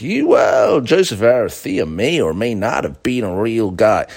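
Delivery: hammed-up documentary interviewee hedging his words; mock TV talking head. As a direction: ham up a hedging expert on a documentary. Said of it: "say things like".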